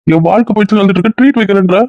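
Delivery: can't believe she'd celebrate his heartbreak; incredulous. Acted. Friend offers party after breakup.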